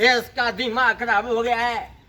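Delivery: mock outraged listener, pitch climbing; imagined heckler. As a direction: mimic an indignant audience member. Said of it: "आप सोच रहे होंगे".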